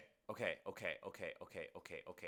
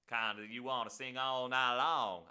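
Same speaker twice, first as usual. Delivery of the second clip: lightly hammed-up Southern accent, louder; bad Southern accent; 3 semitones higher, 7 dB louder than usual. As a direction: put on a not-very-good Southern twang, louder. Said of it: "I'm doing a Southern accent".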